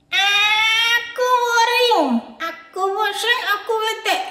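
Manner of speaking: put-on high, drawn-out singsong whine of a sulky child; the bored boy Adit whines from off-screen about being stuck home; whiny bored kid. Bored child puppet whining.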